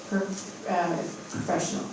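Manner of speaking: too quiet. Real, not acted. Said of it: "Say it again".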